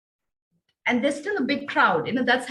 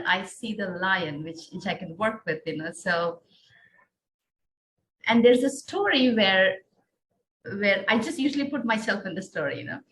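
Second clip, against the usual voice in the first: ordinary talk, nothing marked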